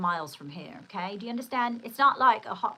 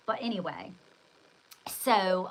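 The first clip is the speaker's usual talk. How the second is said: normal talking voice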